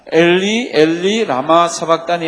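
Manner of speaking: loud, drawn-out scripture cry; crying out loudly. Acted. Preacher leads congregation in reading Jesus' cry, loud. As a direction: read it out at full voice. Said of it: "예수께서 크게 소리 질러 가라사대".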